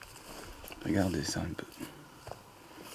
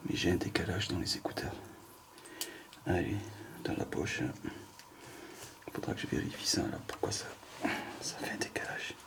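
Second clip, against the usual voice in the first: quiet whispered aside; whispering; 3 semitones lower, 7 dB quieter than usual. Real, not acted. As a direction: mutter it under your breath. Fiddling with earphones, whispering aside.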